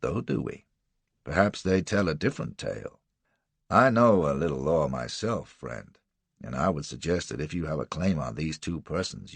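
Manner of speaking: low, slow, cool drawl; unhurried and unanswerable; steady drawl. Acted. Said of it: "his drawl was steady as ever".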